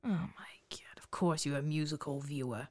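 whispered mockery; scornful whisper. Real, not acted. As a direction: hiss it aside in disdain. Mocking aside about musicals.

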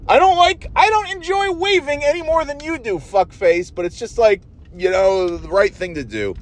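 high, syrupy fake-nice voice; sugar-coat the insult; mock sweet neighbor. Scolding a neighbor who won't wave back, high fake-sweet voice.

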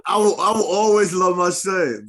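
high, loud, drawn-out mock cry; mock tearful. Father declaring love for his son, hammed-up crying. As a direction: plead slowly through put-on tears. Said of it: "I be crying like shit".

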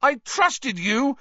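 high, slow, loud betrayed shout; furious shout. A narrator's reading. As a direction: shout it shaking with fury. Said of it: "he shouted at Lupin".

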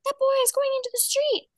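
put-on high childish blurt; squeaky little girl tattling; mock kid voice, high; D W's voice.

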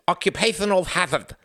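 "Occupational hazard" high-pitched, its sibilants lisped; pretend lisp. A narrator's reading. Frederick jokes about his diplomatic speech, lisping. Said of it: "pretending to lisp".

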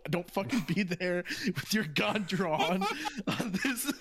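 slow, laughing through the quote. Real, not acted. Texting his armed friend, laughing out the warning. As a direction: giggle through the words as you read them.

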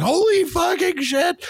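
high, slow, awestruck exclamation; like seeing a hero return out of nowhere, dragged out in shock; stunned disbelief. Retold or imitated. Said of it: "was like".

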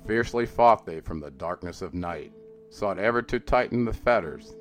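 ordinary delivery

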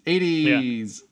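exasperated. Real, not acted. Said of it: "said again louder".

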